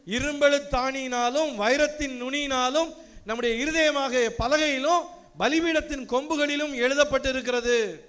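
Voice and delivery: solemn, lifted reading voice; preacher reading Revelation aloud to the congregation, solemnly; scripture reading. Acted. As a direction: proclaim the vision from Revelation. Quotes scripture.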